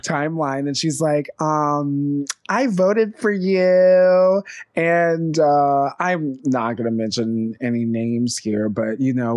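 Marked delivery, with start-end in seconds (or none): 2.5-4.4 mocking quote. Mocking a fan's comment.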